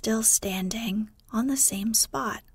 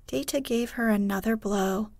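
plain reading voice

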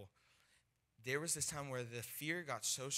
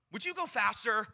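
higher, loud impatient demand; impatient heckle; 9 semitones higher, 10 dB louder than usual. Retold or imitated. Woman hurrying the young evangelist along, impatient. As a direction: cut in, loud and high, to hurry him.

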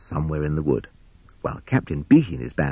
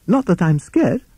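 high, defensive toad voice; hurried timid Toad; 13 semitones higher, 4 dB louder than usual. Acted. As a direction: blurt it as a frightened toad in denial. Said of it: "said Timid Toad hurriedly".

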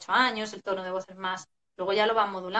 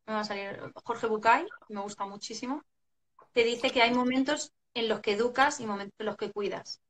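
usual speaking voice

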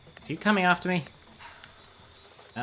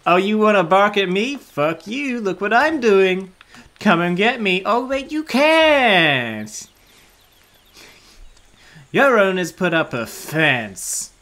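higher, louder mocking taunt as the dog; dog sneering at the chihuahua, higher and louder; smug dog voice; 7 semitones higher, 8 dB louder, slower than usual. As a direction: gloat like a cheeky dog behind the fence.

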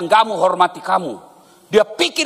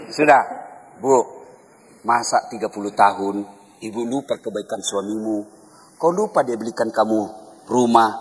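gentle counsel; 8 semitones lower than usual. Retold or imitated. Ustaz counsels wife gently.

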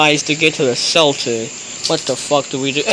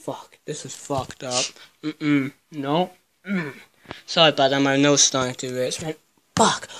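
ordinary talk

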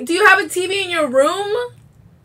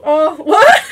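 ordinary delivery

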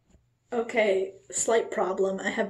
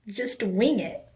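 ordinary talk, nothing marked

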